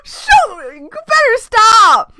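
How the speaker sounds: loud high panicked yells; frantic screaming. Real, not acted. Gamer screams at the girl to stop. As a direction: yell at her to stop.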